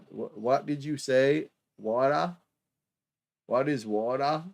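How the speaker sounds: a poor imitation of an American accent, baffled; mock American accent. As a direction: play the confused American clerk, slowed down. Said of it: "terrible, terrible, uh, American accent there".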